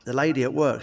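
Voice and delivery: ordinary delivery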